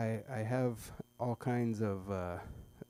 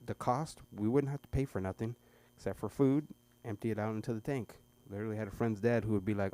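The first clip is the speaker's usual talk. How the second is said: usual speaking voice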